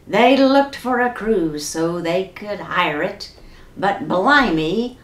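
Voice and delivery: ordinary delivery, nothing marked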